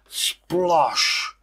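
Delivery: plain reading voice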